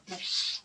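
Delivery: loud, voiceless whoosh; imitated fire hose blast. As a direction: mimic a burst of spraying water. Imitates fire hose being turned on.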